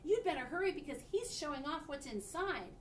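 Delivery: urgent call. A narrator's reading. Friend urging her to hurry.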